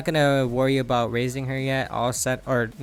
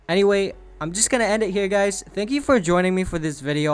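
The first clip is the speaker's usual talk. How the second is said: cheerful outro; 6 semitones higher than usual. Real, not acted. Upbeat outro sign-off.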